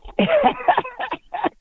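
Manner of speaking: high-pitched giggling cackle; high laugh. Real, not acted. Caller amused by host's joke.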